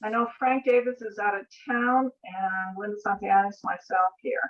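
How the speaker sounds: normal talking voice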